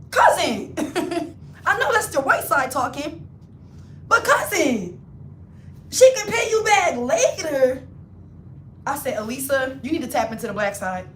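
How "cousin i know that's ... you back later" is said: high, louder disbelieving appeal; exasperated. Retold or imitated. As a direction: plead with her cousin in disbelief. Exasperated reply to cousin.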